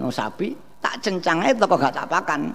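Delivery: normal talking voice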